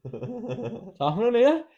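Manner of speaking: slow, high, wary question; a half-asleep mate catching a prank; slow suspicious drawl. Retold or imitated. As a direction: stretch it out suspiciously. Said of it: "he'd just be like".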